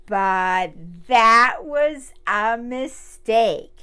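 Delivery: slow and loud with weight; slow loud dramatic. Acted. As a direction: drag it out loud like doom is coming. Ant hits the pepper.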